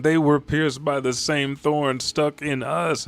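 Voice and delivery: put-on high, slow child's plaint; imitated tearful kid. Another kid explaining the wounds, tearful. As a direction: lightly act a tearful child, drawn out. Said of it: "All these kids are in tears".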